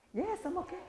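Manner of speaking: high, light and upbeat answer; cheerful reply demo. Shown as a demonstration. Trainer acting the upbeat reply to the greeting, light and high. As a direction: answer brightly that all is fine. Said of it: "harus keluarin suara kan baik juga".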